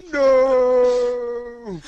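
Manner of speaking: high, loud anguished no; dramatic cry. Pretend. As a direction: wail it like a movie villain's end.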